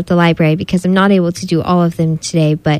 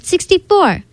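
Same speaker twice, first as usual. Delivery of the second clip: higher, a hammed-up kid's shout; shouted guess; 11 semitones higher than usual. A narrator's reading. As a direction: call the answer out across the class. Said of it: "Tanika shouted".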